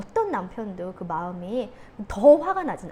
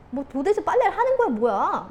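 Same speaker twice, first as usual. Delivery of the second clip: raised, higher-pitched grumbling complaint; mock annoyed husband; 6 semitones higher, 5 dB louder than usual. Husband to wife about laundry, mock annoyed. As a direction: imitate an irritated husband nagging.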